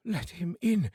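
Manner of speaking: plain reading voice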